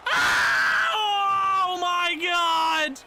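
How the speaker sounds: high, loud cry of dismay; real gamer despair; anguished shout. Real, not acted. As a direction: scream at the screen in horror. Late goal against his team.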